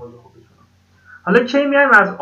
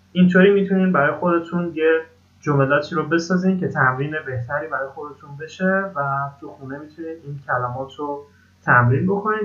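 usual speaking voice

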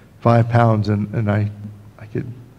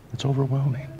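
softer, hushed repeat; hushed awe; 3 semitones higher, 7 dB quieter than usual. Real, not acted. Pastor repeating his amazement to himself, hushed. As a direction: let it sink in, quieter.

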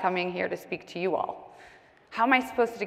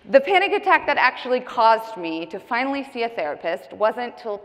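ordinary talk, nothing marked

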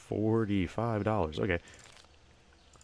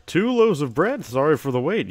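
high bright sing-song shop greeting, louder; cheery shopkeeper; 6 semitones higher, 7 dB louder than usual. Acted. Shopkeeper hailing a customer entering the store, cheery and loud. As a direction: welcome customers like an eager shopkeeper.

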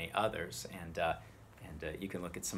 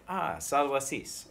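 acted greeting; 7 semitones higher, 6 dB louder, slower than usual. Shown as a demonstration. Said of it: "go up to someone and say".

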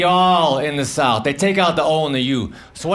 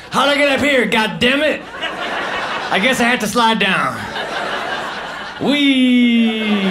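higher, hammed-up feigned confusion; fake innocent surprise; 4 semitones higher than usual. Adult at the top of a slide, mock innocent surprise. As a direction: act like you ended up here by accident. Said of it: "act like I got there accidentally".

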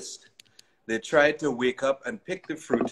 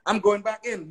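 higher-pitched, lightly declared resolve; announcing; 9 semitones higher than usual. A narrator's reading. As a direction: announce it, voice lifted. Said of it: "he announced".

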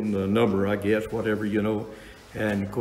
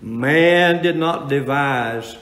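preaching at full force; 3 semitones higher, 5 dB louder than usual. Acted. Preacher to congregation about justification, loud and slow. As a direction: hammer home God's work, slowly.

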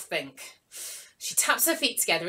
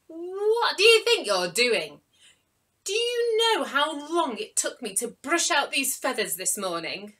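posh offended pigeon; 6 semitones higher, 7 dB louder than usual. Acted. Upset posh pigeon scolds.

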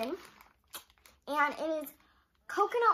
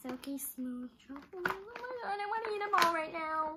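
normal talking voice

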